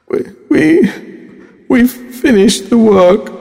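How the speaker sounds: high, stammering start; nervous. Shown as a demonstration. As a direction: stutter the opening word nervously. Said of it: "according to the speaker's tone of voice".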